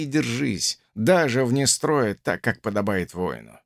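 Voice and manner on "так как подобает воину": ordinary delivery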